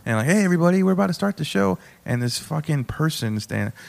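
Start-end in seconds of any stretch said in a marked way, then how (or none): 0.3-1.8 higher-pitched, upbeat host opening; show host's greeting. Retold or imitated. Host opening her comedy show, imitated announcement. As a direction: imitate a host calling the room to order.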